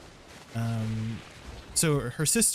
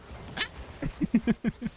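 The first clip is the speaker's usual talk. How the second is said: Toad-like laugh; 10 semitones higher than usual. Pretend. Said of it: "How does Toad sound?".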